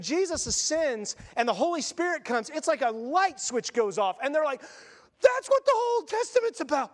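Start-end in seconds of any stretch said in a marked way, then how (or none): 5.2-6.9 much higher, excited aha; sudden realization. Retold or imitated. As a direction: burst out as it all clicks.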